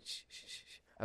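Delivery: soft unvoiced shushing; hushing. Real, not acted. Hushing her dog near the microphone, whispered.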